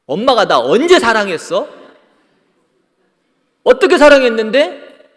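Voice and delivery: put-on high, loud child demanding proof; a child snapping back at mom; sulky child talking back. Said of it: "근데 자식이". (엄마에게 대드는 아이처럼 높고 크게)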